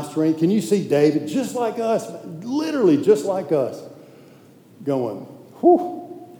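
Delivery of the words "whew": a high, airy rush of breath with no voice; breathy whew. Retold or imitated. Imitating David, a high breathless whew of relief. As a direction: let out a relieved whoosh of air. David exhaling before praising God.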